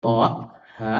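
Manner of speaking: "toha" split as "to', ha'", each stopped short; separate letter names. Shown as a demonstration. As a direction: break "toha" into two checked syllables. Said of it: "dibacanya bukan". ("toha" dipisah menjadi to', ha' dengan hamzah)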